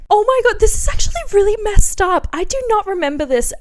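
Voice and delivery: startled oh my God; shocked. Real, not acted. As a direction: react with real shock. Shocked by old diary.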